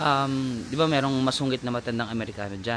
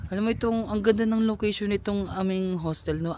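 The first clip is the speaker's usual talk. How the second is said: ordinary talk, nothing marked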